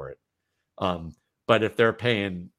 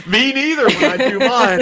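real laugh, much higher and louder; bursting out laughing mid-sentence; 13 semitones higher, 9 dB louder than usual. Real, not acted. Laughs at host's editing joke.

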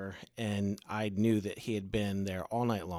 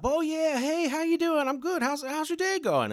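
much higher, louder, cheery stranger's voice; cheerful man; 6 dB louder than usual. Retold or imitated. Man on newspaper greets the worried driver, high and chipper. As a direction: play the man popping up all breezy and upbeat.

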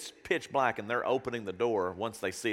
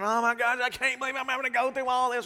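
high whiny exasperated complaint; mock a frustrated whiner; hammed-up whining; mock complaining; 13 semitones higher than usual. Mocking a complaining listener.

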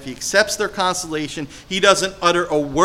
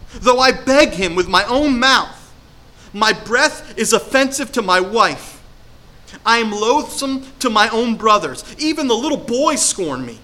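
rising, pleading read of Job's lament, slowing on key lines; scripture lament reading; 4 semitones higher than usual. Acted. Preacher reading Job's lament to the congregation, pleading. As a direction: let the ache of the lament build, then sink.

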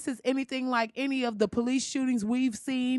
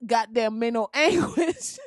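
ordinary talk, nothing marked

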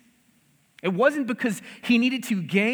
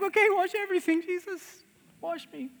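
mock eager Peter; 10 semitones higher than usual. Peter overcorrects, begging to be washed.

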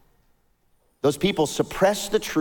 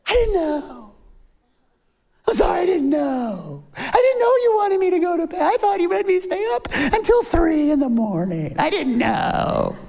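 put-on whining child; 4 dB louder than usual. Child making excuses to a parent after acting up, whining. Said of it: "Your kids ever act up and do something".

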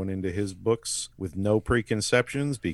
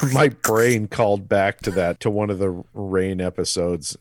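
ordinary delivery

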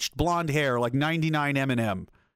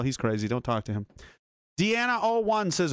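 ordinary talk

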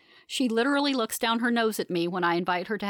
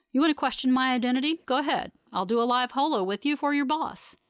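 higher-pitched, defiant retort with building force; heated challenge; 6 semitones higher, 3 dB louder than usual. A narrator's reading. Officer daring the prisoner to doubt who they are, heated. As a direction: dare them, pitch raised, punching the insult.

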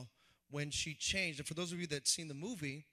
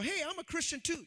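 mock stranger voice; 11 semitones higher, 4 dB louder than usual. Voicing a stranger on street.

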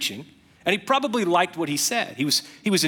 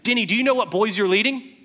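incredulous question; 6 semitones higher than usual. Retold or imitated. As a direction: ask it in open disbelief. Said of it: "And I said".

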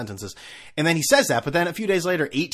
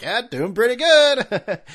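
much higher, louder, slow and chirpy; mock cheery reply; 11 semitones higher, 8 dB louder, slower than usual. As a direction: play along with overdone sunny cheer. Forced cheerful reply at checkout.

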